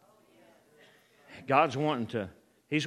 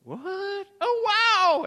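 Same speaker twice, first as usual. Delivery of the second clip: very high mock amazement, building louder; astonished; 7 dB louder, much slower than usual. Preacher mimicking us when prayer is answered, astonished. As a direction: go from squeaky disbelief to awe. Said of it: "we're, we're astonished".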